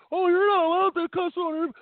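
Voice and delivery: high, mocking whine of the scolding owners; mocking the station owners. Host mimics station owners who scolded him, high mocking whine. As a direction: sneer their scolding back at them. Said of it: "getting cussed out by the daggone owners".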